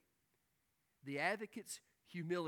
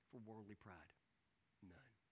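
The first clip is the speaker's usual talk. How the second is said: quiet emphasis; 5 semitones lower, 15 dB quieter than usual. Acted. Preacher drops to hush.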